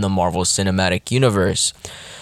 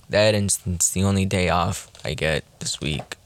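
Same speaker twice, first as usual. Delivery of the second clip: ordinary talk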